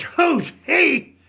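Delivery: high, disbelieving cry of who's he; stunned that she has never heard of him; mock outrage, pitched well up; incredulous outburst. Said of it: "He says".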